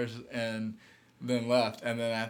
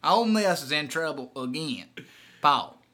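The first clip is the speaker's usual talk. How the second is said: Southern caller, laughing; 2 semitones higher, 5 dB louder than usual. Pretend. Hank griping to Paul about Ole Miss, laughing twang. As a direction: play the caller while cracking up. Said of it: "That's a good character".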